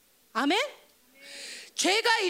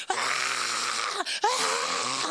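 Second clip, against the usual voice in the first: long drawn-out wail of pain; acted groaning scream; agonized creature's cry; much slower than usual. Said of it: "고통스러워서".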